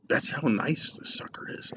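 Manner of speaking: hushed, breathy whisper; whispered aside. Real, not acted. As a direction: whisper it like a secret. Whispered aside about cigar.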